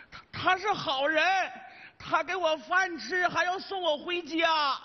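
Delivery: high, very slow, drawn-out plea; confused old father. Acted. Confused old man vouching for the salesman, earnest. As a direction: stretch each phrase high, like a childlike elder.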